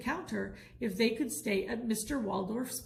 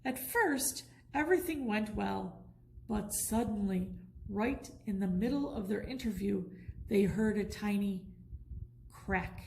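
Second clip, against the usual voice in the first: usual speaking voice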